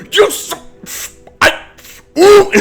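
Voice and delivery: very high, loud sputter; a king too angry for words; the king's cartoonish meltdown, spluttering; spluttering outrage.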